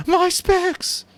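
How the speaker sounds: high, loud panicked yelp; terrified cry. A narrator's reading. Piggy crying out as his glasses fly off. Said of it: "Piggy cried out in terror".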